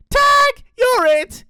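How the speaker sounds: very high, loud bursts; gleeful tag shout. Acted. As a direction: shout it triumphantly, word by word. Dingo catches a joey.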